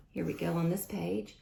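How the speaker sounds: ordinary delivery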